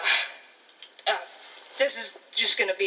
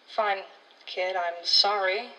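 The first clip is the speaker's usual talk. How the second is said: ordinary delivery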